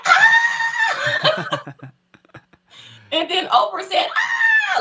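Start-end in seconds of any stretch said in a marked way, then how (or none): 0.1-1.7 retold scream. Sister shrieking as Oprah walks in, high squeal. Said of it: "my sister was like". 4.2-4.8 high teasing shriek back; mock scream. Oprah mocking the fans' scream, high playful shriek. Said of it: "She just made fun of us".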